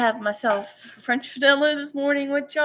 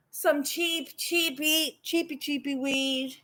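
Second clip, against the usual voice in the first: high, soft cutesy sing-song; 3 semitones higher, 6 dB quieter than usual. Real, not acted. Vlogger joking about cheap weed, cutesy high. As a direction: squeak the cheapy bits. Playful baby-talk repetition.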